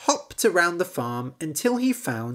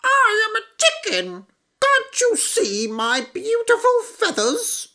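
very high, louder hen voice, proud; chicken voice; 5 dB louder than usual. A narrator's reading. Chicken boasting to frog, very high voice. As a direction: preen as a vain chicken. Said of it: "chicken speaks".

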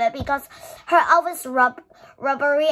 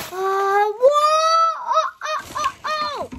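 high, rapid excited ohs; thrilled at a new toy car; 13 semitones higher than usual. Real, not acted. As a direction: bounce with excitement.

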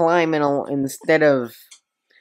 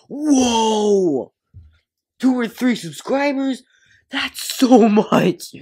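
hammed-up shock, much higher and louder; sarcastic amazement; 6 semitones higher, 5 dB louder, slower than usual. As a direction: gush over tiny numbers with fake awe. Sarcastic awe at three subscribers.

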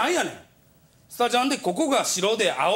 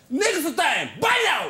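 mock sign-off shout; 6 semitones higher, faster than usual. Nakao shouting his farewell into the voicemail. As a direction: belt out a goofy farewell. Quoting senior's odd sign-off.

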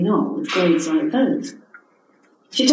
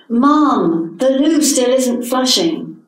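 put-on louder, higher child calling Mum; child calling from upstairs to Mum, louder and higher; 5 semitones higher, 4 dB louder, slower than usual. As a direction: call out from another room to Mum.

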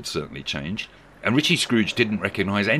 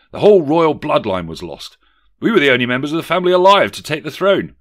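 higher, louder dramatised account; lightly voiced Harry; 6 semitones higher, 7 dB louder than usual. Harry explaining the disaster to Megan, lightly dramatised. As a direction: recount it with indignant emphasis. Acts Harry telling the tale.

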